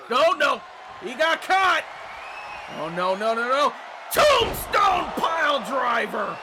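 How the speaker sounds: loud, high excited play-by-play; wrestling commentator. Acted. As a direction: call the big moves like a ringside announcer.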